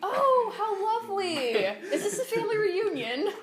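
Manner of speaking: high, gushing, overly sweet; sweet high villager. Acted. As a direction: gush it out brightly. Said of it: "Steve is asking".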